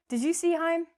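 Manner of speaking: playful wrong Heim question. Shown as a demonstration. Teacher joking with students, wrong long-i Heim. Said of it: "We don't say".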